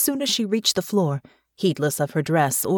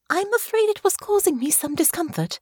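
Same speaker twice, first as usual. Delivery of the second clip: put-on polite young lady; 13 semitones higher than usual. Honor voiced in the story.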